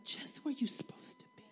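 a sudden hushed whisper. Real, not acted. Pastor whispering the end of the point to the church. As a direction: drop it to a whisper. Sermon line dropped suddenly.

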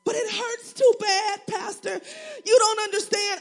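high, slow, loud pained plea; pleading complaint. Retold or imitated. Churchgoer pleading with the pastor, high and pained. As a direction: cry out like someone hurting.

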